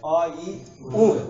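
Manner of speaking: अ, ई, उण with a push on उण; stress on the ending. Shown as a demonstration. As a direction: lean hard and high on the closing उण. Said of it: "थोड़ा सा जोर दिया जाएगा".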